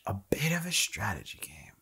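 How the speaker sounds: hushed, whispered echo; mock whisper. Echoing co-host's game description, mock whisper. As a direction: whisper it back teasingly.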